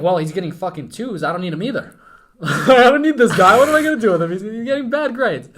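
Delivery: loud, high-pitched exasperated dad impression; mock angry dad. Dad refusing to take his son back, mock outrage.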